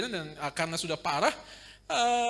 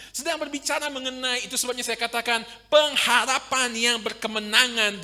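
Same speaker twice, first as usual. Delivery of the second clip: loud, high preaching with slow drawn-out climaxes; full-force sermon delivery; fiery preaching; 6 semitones higher, 5 dB louder, slower than usual. Acted. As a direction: preach it out to the congregation.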